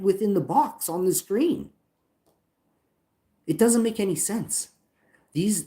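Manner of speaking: high-pitched indignant rant; real exasperation. Real, not acted. Streamer complains support missed his message, exasperated.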